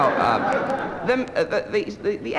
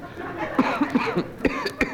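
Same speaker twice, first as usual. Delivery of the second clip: muffled cough; 14 semitones higher than usual. Shown as a demonstration. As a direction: cough as if into a hanky. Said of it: "perhaps if you could cough into a handkerchief".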